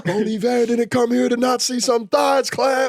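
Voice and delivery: hype man shout. Acted. Hype man yelling to the crowd, high and loud.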